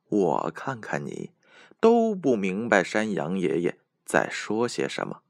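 ordinary delivery

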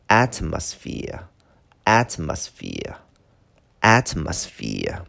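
"Atmosphere" repeated slowly, weight on the opening "At"; stress on first syllable. Shown as a demonstration. Teacher drills a long word for learners, front-stressed "Atmosphere". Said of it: "它的重音是在前边".

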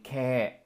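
"ke" with the e stretched long; a slow, drawn-out "ke"; long vowel. Shown as a demonstration. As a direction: hold "ke" slowly as a long vowel. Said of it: "长音ke".

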